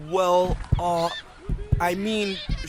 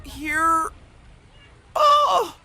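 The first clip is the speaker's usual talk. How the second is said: nervous squeak; much slower than usual. Acted. Bean at the top of the slide, squeaking with fear. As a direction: voice leaps very high, halting with fright.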